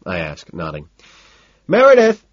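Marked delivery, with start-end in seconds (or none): 1.7-2.2 high, loud call across a room; ringing, high-pitched shout. A narrator's reading. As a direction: call someone over the party noise. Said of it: "he calls out".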